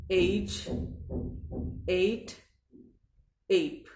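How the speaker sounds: slow, each word apart with the English A sound; example words. Shown as a demonstration. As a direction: say एज, एट, एप with an "ay" glide. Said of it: "उदाहरण".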